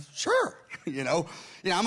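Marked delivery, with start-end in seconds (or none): 0.1-0.6 squeaky mock sure. Mock agreeing, high squeak.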